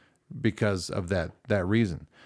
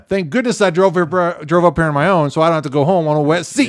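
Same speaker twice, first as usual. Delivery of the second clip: high, loud, hammed-up relief; mock relief; 5 semitones higher, 6 dB louder than usual. Replaying his jerk thought to his friend, loudly relieved. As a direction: gush with exaggerated self-serving relief.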